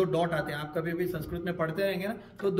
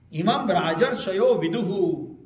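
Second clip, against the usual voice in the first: slow, louder verse recitation; 6 dB louder, slower than usual. Shown as a demonstration. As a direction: intone the line deliberately. (श्लोक का धीमा पाठ)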